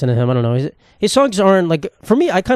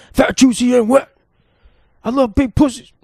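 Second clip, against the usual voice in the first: high put-on singer voice, hammed-up; 9 semitones higher than usual. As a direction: camp it up like a crooning pop star. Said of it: "And I was like".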